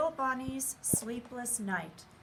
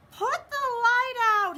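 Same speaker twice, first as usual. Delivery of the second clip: high, loud demand; bunny shouting at Owl; cranky shout; 14 semitones higher, 10 dB louder than usual. A narrator's reading. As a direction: yell it, blinded and tired. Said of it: "he shouted".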